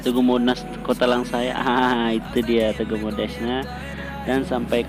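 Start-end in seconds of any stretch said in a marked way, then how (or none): none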